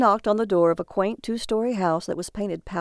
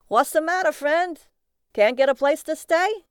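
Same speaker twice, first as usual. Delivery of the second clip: high, loud cabbie voice; friendly cabbie hail; 8 semitones higher, 4 dB louder than usual. Acted. Cabbie asking a man about lodging, calling out. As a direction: call over to a stranded passenger, pitched up.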